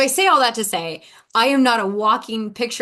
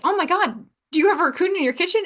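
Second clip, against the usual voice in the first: higher, fast mock panic; mock alarm; 9 semitones higher, faster than usual. Retold alarm at raccoon news.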